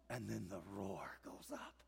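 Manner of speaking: slow breathy whisper; hushed whisper. Acted. Preacher hushing the congregation before the crowd's roar, whispered. Hushed setup after the crash.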